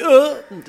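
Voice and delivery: put-on high, loud squawk like a crying baby sea lion. Father imitating his baby Jonatan's sea-lion-like crying, high squawk. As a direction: bark out the baby's sea-lion wail. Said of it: "to je takový lachtaní pláč".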